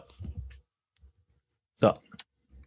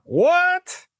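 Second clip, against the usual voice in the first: high, drawn-out astonished cry; mock shock. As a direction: play up the disbelief with a big pitch leap. Mock shock at breaking news.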